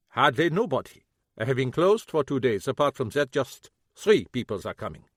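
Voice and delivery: lightly voiced old German man, bitter; old German shoemaker, a hint of German accent; German-accented cobbler. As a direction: grumble it with a German accent, grieving.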